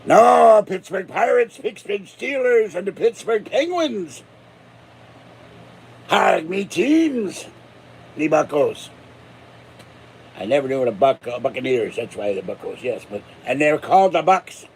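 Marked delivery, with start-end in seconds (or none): 6.1-8.9 slow, growled pirate-speak, lightly done; mock pirate talk. Cheering for Pittsburgh teams in slow, mock pirate-speak. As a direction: drag it out like a proud old buccaneer.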